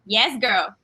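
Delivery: usual speaking voice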